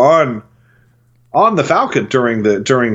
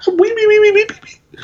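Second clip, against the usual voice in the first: mechanical crying doll. Pretend. Goofing through nonsense sounds.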